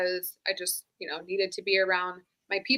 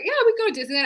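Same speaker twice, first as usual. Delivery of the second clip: much higher, bright eager yes; cheerful promise to daughter; 13 semitones higher, 4 dB louder, much faster than usual. Retold or imitated. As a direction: chirp it like a happy mom. Answering her daughter cheerfully.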